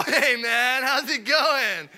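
Apple tech greeting customer like a surfer dude; stoner surfer voice. Retold or imitated. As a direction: play the chill stoner tech guy. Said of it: "just like Jeff Spicoli".

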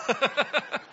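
a quick high burst of laughter. Real, not acted. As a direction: laugh at the joke. Laughing at own joke.

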